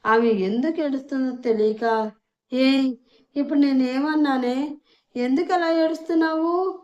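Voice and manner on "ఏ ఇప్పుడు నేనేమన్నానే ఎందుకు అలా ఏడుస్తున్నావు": higher, louder panicky asking; rattled and pleading, pitched up; a flustered man at a crying woman. A narrator's reading. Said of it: "కంగారుగా అడిగాడు".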